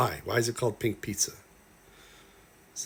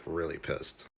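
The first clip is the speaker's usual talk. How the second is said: normal talking voice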